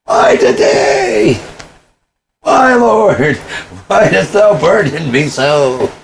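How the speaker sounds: high, slow, wailing cry to the heavens; mock anguished lament. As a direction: ham it up like a biblical sufferer.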